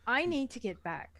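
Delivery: usual speaking voice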